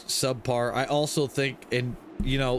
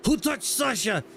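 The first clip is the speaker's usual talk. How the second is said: lightly voiced Russian heavy gunner, higher; quoting the Team Fortress gunner in a higher voice; imitating the Heavy; 7 semitones higher than usual. Co-host quoting the game gunner, mock Russian voice. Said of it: "the heavy gunner".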